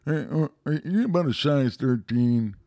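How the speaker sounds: Buffalo Bill. Acted. Impressionist replaying the movie, Buffalo Bill impression. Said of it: "like, Buffalo Bill".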